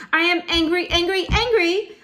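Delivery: high, very loud tantrum cry; enraged shriek. Acted. Teacher acts a girl throwing a fit, enraged shriek. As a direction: shriek each "angry" in acted rage. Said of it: "shrieked enraged Belinda Blue".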